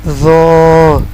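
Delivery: "زو" held long with rounded "o"; tongue at upper teeth, hold heavy "زو"; correct letter zo. Shown as a demonstration. Teacher gives the example, "زو" drawn out. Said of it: "اوپر والے دانتوں کی اندرونی کنارے سے".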